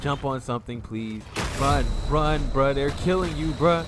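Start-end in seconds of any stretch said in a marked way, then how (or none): 1.6-3.8 frantic loud panicked yelling; being chased. Real, not acted. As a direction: shout in alarm as they die. Companion being attacked.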